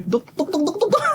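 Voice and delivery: high, soft mock-panicked calls through laughter; rapid high-pitched pleading, laughing; imitated patient's plea. Telling how a brother called to the doctor, imitated plea.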